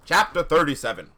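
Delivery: projected narrator announcement; announcing. Acted. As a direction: belt out the heading to restart the show. Restarts heading in performing voice.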